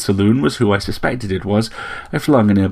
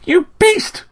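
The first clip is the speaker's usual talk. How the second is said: put-on very high, indignant girl's cry; outraged cry; 3 dB louder than usual. A narrator's reading. Athnal blames the narrator for her pig, outraged cry. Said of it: "cried Athnal".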